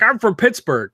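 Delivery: lightly mock a Pittsburgh accent; Tom Hardy Pittsburgh accent. Mocking Tom Hardy's Revenant role in a light Pittsburgh accent. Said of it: "in The Revenant, he's like".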